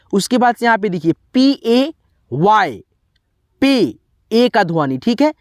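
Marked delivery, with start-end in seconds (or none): none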